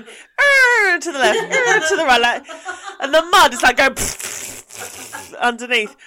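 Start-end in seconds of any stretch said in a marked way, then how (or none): 0.0-1.0 high loud groaning "Er" of a sliding car; mock skid groan. Car sliding in the mud, imitated with a high groaning "Er". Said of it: "the car's going". 1.4-1.9 high loud groaning "Er" of a sliding car; mock skid groan. Car sliding in the mud, imitated with a high groaning "Er". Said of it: "the car's going".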